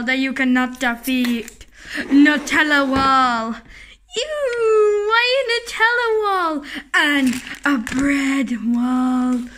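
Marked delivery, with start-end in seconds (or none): 4.2-6.6 whiny. Acted. Whiny reaction in play.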